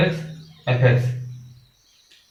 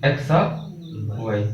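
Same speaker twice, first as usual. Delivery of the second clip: very slow x of वाई with each word held; teacher slowly repeating x of वाई, a little softer; slow model reading; 5 semitones lower, 5 dB quieter, much slower than usual. Shown as a demonstration. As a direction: drag out x of वाई for the class to copy. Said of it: "ये शुद्ध तरीका यही है".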